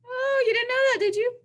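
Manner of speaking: high, mock-surprised tease; teasing surprise. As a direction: pretend to be amazed. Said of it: "allows you to be surprised".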